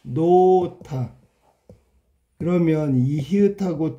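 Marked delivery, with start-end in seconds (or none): 0.0-1.1 노타 drawn out very slowly, syllable by syllable; slow model reading of 노타; slow pronunciation demo. Shown as a demonstration. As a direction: stretch 노타 out slowly so students hear each sound. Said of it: "발음해봐".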